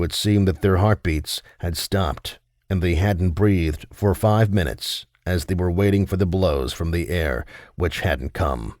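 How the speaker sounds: ordinary delivery, nothing marked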